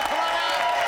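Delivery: announcer calling guests on stage. Acted. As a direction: call them out to the stage. Host calls the ladies out.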